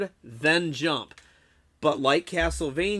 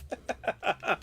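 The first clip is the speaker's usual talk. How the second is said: high, softer tail of laughter; 6 semitones higher, 5 dB quieter than usual. Real, not acted. Streamer finishes re-enacting his rant, high laugh. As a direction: laugh at your own tirade.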